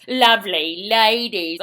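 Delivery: slow, loud mock-Cockney song line; horrible Cockney accent. Prostitutes singing by the docks, horrible Cockney accent. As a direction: caricature a Cockney chorus, drawn out. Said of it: "They all sing with a horrible Cockney accent".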